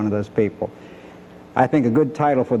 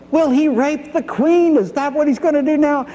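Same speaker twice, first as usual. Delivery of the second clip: the king bursting out in fury at Haman; furious king; 12 semitones higher than usual. Retold or imitated. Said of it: "he's just so furious".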